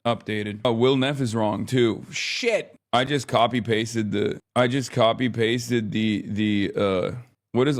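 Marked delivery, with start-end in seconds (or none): 2.1-2.7 surprised curse. Real, not acted. Realizes another tagging mistake.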